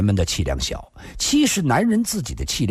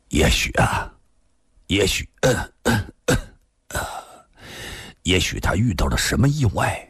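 forced, hesitant; 5 semitones lower than usual. A narrator's reading. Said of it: "勉强地笑了".

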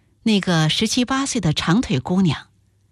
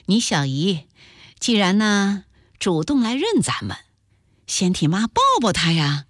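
ordinary delivery, nothing marked

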